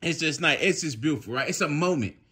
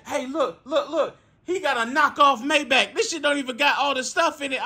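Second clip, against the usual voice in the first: higher imitated teasing voice; mocking kid; 10 semitones higher, 4 dB louder than usual. Kid on FaceTime showing off the car, mocking. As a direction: imitate a kid loudly making fun.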